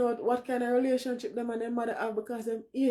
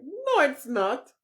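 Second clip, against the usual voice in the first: slow, firm, louder no; emphatic denial; 5 semitones higher, 5 dB louder, slower than usual. Real, not acted. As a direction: insist it slowly and firmly. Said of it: "emphatic rebuttal".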